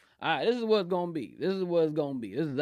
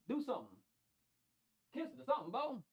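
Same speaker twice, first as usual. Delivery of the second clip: sweet pet-coaxing voice; coaxing pet talk; 8 semitones higher, 13 dB quieter, much faster than usual. Real, not acted. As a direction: coax the little pet gently.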